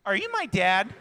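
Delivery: put-on mischievous little-girl voice; imitated little daughter. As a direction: ask it for everyone around to hear. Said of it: "she was, like, waiting for people".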